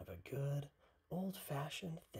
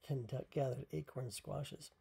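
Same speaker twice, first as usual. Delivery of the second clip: ordinary delivery, nothing marked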